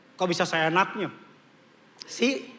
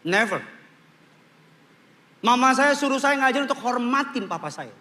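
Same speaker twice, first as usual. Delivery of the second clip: ordinary delivery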